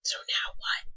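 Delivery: hushed, voiceless aside; soft secretive murmur; conspiratorial whisper. Pretend. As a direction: whisper as if the mic might be off. Pretend aside, as if off air.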